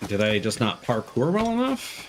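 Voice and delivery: usual speaking voice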